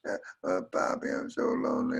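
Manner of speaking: high-pitched mock sobbing; sobbing little girl. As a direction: wail through pretend sobs to Papa. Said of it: "deep, sobbing".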